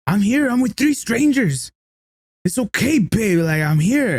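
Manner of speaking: high, stretched-out protest into a phone; mock yelling. Stranger reassuring his girlfriend by phone, mock yell. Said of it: "he's yelling like".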